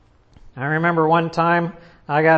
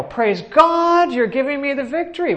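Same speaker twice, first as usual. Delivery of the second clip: praising God; 6 semitones higher, 4 dB louder than usual. Retold or imitated. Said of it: "I go".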